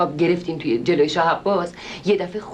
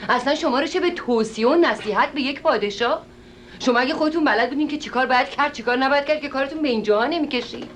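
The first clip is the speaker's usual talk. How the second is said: higher-pitched indignant telling-off; angry scolding; 8 semitones higher than usual. Acted. Lady berating the show host over his advice to kings, indignant higher scolding. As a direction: scold him with rising indignation.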